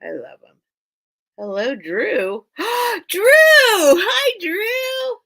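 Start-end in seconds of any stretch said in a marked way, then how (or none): 2.5-3.0 delighted gasp. Real, not acted. Sees friend Drew join. 3.1-5.2 much higher, louder delighted hello; live seller spotting her friend Drew in chat, thrilled hello; excited greeting. Real, not acted. Greets friend joining live stream.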